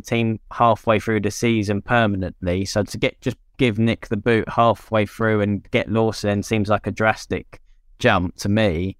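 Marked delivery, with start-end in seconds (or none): none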